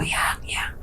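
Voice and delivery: soft unvoiced whisper; whispered reassurance. Husband calming his scared wife, whispering. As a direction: hush her gently.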